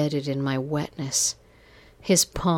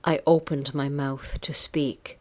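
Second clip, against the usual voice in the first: plain reading voice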